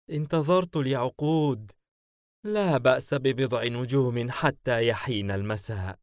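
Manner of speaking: ordinary delivery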